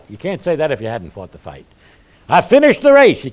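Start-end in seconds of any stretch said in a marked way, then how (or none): 2.3-3.2 high, loud proclamation; mock triumphant declaration. Dying believer claims a finished life, loud mock triumph. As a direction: proclaim it at full voice, pitched way up. Acted deathbed boast of faith.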